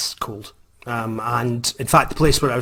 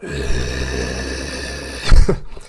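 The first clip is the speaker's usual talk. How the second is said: imitating a bear's growl; 12 semitones lower, 3 dB louder than usual. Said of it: "It was kind of going".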